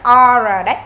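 normal talking voice

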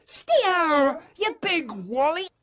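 high, loud teasing yell; mock shout. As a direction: yell it like the kid did. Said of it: "Shouts Haribo".

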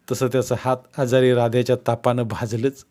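plain reading voice

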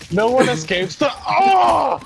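high, slow, loud catchphrase; booming hero voice. Retold or imitated. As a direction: proclaim it like a game hero. Repeating catchphrase.

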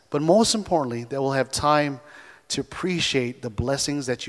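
soft, hushed prayer voice; family prayer. Shown as a demonstration. Pastor voicing his family's prayer before a trip, soft prayer. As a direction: pray quietly over the trip. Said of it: "just stop and say, Okay, let's pray".

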